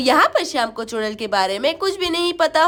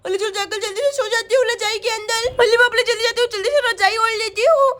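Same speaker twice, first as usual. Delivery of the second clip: put-on lisping baby-talk, scared and fretting; frightened little girl; 5 semitones higher than usual. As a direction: play a small child hiding in fear. Voices frightened Sonam. (डरी हुई तोतली बच्ची)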